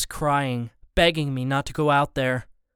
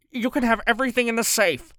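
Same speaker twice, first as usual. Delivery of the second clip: put-on higher, anxious older man; bargaining husband; 8 semitones higher, faster than usual. A narrator's reading. Mister Borden offers the safe to the gunman. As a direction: offer it fast to end this.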